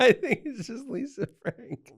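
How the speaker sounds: high, loud, laughing through the words. Real, not acted. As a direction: say it cracking up, pitch shooting up. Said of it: "I don't know why I'm laughing".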